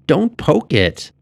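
put-on high, drawn-out little-girl whine; whiny girl. A narrator's reading. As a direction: whine it slowly in a high girl's voice. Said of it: "came a whiny voice of a girl".

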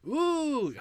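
very high falsetto swoon; high swooning ooh. Acted. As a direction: squeal like a swooning fan.

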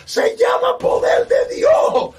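high, loud revival shout easing slightly; impassioned preacher proclaiming power; fervent preaching. Acted. Preaches at full force.

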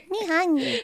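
high, dopey "me hungry" bear; caveman bear voice. Pretend. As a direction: laugh through a dumb monster voice. Bear voice in a joke.

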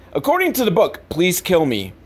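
ordinary talk

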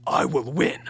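mock anime war cry; anime fighter growl. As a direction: snarl it like a powering-up monster. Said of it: "all you hear is".

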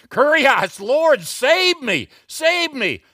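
loud, high acted plea, stretched out; desperate cry for help. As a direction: cry out to be rescued from drowning. Acted cry of sinking Peter.

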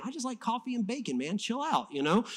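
all cool. Retold or imitated. Elisha answering his panicking servant, all cool. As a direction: play it unbothered, rattled by nothing. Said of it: "comes out, is all cool".

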